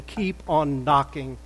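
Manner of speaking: ordinary talk, nothing marked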